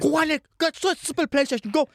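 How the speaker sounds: high-pitched imitation of being sent away; worked-up retold scolding; mock exasperated dismissal. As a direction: shoo them off in mock exasperation. Acting out Papito sending him away.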